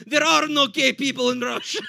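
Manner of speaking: put-on loud, high Russian-accented protest; mock Russian accent. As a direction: deny it indignantly in a Russian accent. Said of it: "Their response was the best".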